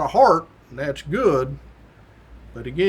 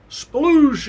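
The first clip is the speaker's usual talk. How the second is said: high, loud "sploosh"; exclaimed sound effect of surfacing; imitated splash; 9 semitones higher, 5 dB louder than usual. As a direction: burst out with a splash noise. Imitates big splash as man surfaces.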